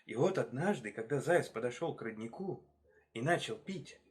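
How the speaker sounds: usual speaking voice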